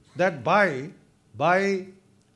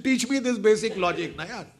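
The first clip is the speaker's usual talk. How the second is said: normal talking voice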